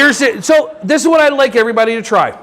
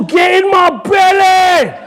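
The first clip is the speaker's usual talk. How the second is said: slow Scottish bellow; Scottish accent; 8 semitones higher, slower than usual. Shown as a demonstration. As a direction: bellow it like a greedy Scot. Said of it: "I do accents".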